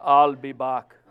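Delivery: deep, laughing, muscle-bound "I'll be back"; Terminator with muscles. Shown as a demonstration. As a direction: say it like a bodybuilder cyborg, chuckling. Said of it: "you gotta say it with muscles".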